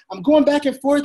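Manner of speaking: high, worked-up excitement; excited. Shown as a demonstration. Coach acting out pacing during a sales call, excited. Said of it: "you see the excitement in my voice".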